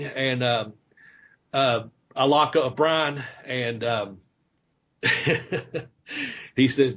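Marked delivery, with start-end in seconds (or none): none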